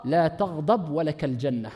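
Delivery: low, soft, slow Arabic recitation; hushed, unhurried, tender quotation; gentle quoted counsel. Retold or imitated. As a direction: give the counsel quietly and slowly. Gently quotes the Prophet's answer.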